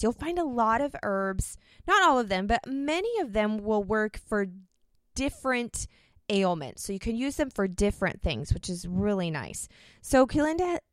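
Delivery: ordinary talk, nothing marked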